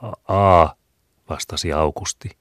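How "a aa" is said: 1 hesitant stammer. A narrator's reading. Child stammers a letter.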